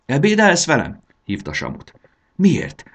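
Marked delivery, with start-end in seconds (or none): 0.1-1.0 eager invitation. Acted. Girl invites dragon. 2.4-2.8 sudden high eager question; perking up. Acted. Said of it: "kérdezte Amos felélénkülve".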